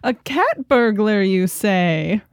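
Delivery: hammed-up, drawn-out villainous "ho ho"; theatrical intrigue. Co-host reacts to cat burglar story, with hammed-up intrigue. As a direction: relish the pun like a melodrama sleuth.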